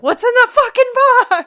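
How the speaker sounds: mock yell. Friends quoting a movie, yelled high and loud. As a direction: yell it like the desperate movie scene.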